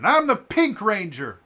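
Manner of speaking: high, loud proud claim; heroic declaration. Retold or imitated. Another civilian joining in, loud heroic claim. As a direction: declare it bravely. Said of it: "Another one".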